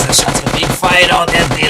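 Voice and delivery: excited play-by-play. Real, not acted. Caster tells viewers shots are being fired, excited.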